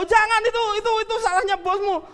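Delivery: high, loud, fast insisting; insistent. Acted. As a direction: wave the worry away, loudly. [name] insists, laughing.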